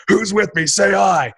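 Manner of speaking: rally the room, lightly mimicked; seminar crowd call. Motivational speaker on tape rousing his crowd, mock hype. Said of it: "he's like".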